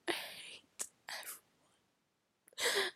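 slow, tearful whisper; crying. Real, not acted. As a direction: voice giving out into a high, faint whisper. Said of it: "I'm crying".

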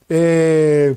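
usual speaking voice

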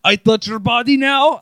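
높고 큰 러시아 억양; 마사지사 올가 흉내, 크게; Russian accent. Retold or imitated. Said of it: "Olga voiced".

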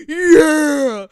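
very loud, high laughing yeah; mock laughing lads. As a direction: guffaw it like cheering mates. Imitating boys laughing along.

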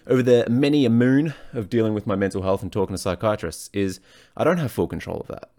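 ordinary talk, nothing marked

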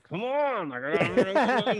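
high, loud comic pleading; hammed-up impatient whine at being left out; mock exasperation. Retold impatience during wives' chat.

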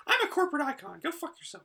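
very high cartoon character voice; mock corporate mascot. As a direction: squeak it like a costumed park icon. Imagined theme-park character addressing children.

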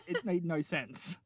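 ordinary talk

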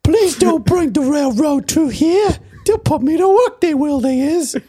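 put-on very high, pleading child voice; pleading little boy. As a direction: whimper like a poor frontier kid. Playing poor American boy.

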